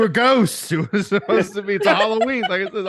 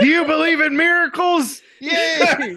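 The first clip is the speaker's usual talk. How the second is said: sportscaster shout; 9 semitones higher than usual. Pretend. Quoting sports movie, shouting.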